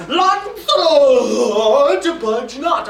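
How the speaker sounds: long, loud stretched-out call; commanding conscience. Acted. Conscience holding Lancelot back from running, loud command. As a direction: bellow the name slowly, then order him firmly. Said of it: "Well, my conscience says".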